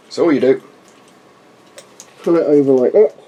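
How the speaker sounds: muffled behind a rubber mask; through a gas mask. Real, not acted. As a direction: talk through the mask's voice diaphragm. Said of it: "voice diaphragm hopefully looks quite good".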